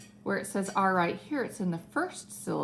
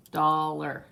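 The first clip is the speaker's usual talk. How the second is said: Dollar drawn out slowly in two syllables; slow syllable split; 3 semitones lower, 4 dB louder than usual. Shown as a demonstration. Teacher showing pupils where it splits, slow Dollar. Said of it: "let's say dollar really slow".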